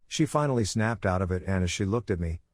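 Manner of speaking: ordinary delivery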